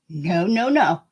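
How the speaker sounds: ordinary delivery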